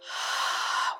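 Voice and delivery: long exhaled sigh. Real, not acted. Meditation leader pausing before sending love, long sigh. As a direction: let out a long, breathy sigh. Audible breath during meditation.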